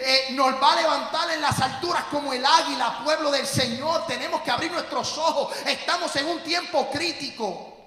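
loud, high, impassioned shouting from the pulpit; fiery preaching. Acted. Pastor exhorting the congregation to rise, full-force preaching shout. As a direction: drive each line home like a battle cry.

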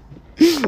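big high burst of laughter; loud laugh. Real, not acted. Laughing at the jokes.

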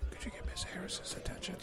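hushed unvoiced whisper. Real, not acted. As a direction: whisper so she won't hear. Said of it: "Why are we whispering now?".

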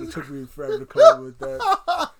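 high, loud, laughing shout. Real, not acted. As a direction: crack up while shouting it. Laughing at own joke.

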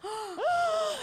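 high, sharp intake of breath; amazed gasp. Real, not acted. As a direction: gasp at a big deal. Reacts to friend saying I love you.

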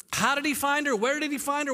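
usual speaking voice

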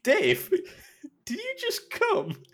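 high, slow quote cracking into real laughter; laughing through a quote. Real, not acted. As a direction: barely get the line out for laughing. Retelling the punchline while laughing.

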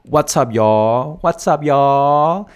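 slow, stretched Texan drawl, pitch rising. Shown as a demonstration. Teaching a Texan greeting, in a slow Texan drawl. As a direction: drag out y'all like a Texan. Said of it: "很典型的德州腔的例子".

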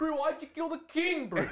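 put-on high, laughing South African accent; South African bro. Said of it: "like, South African accents and things".

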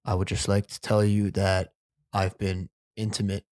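low, measured confession, lightly imitated; a touch of a solemn bachelor's speech; lightly voiced bachelor. Telling three girls he loves them, mock-solemn. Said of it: "and he goes".

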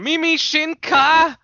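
high, loud, drawn-out cry; hyped mock battle shout; anime evolution call. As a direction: cheer out the transformation, slowly.

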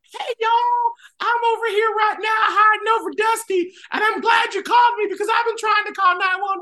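very high squeaky shouted cartoon voice; pine straw voice. Radio host calling in as the pine straw, very high and shouted. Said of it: "got on the phone as the pine straw".

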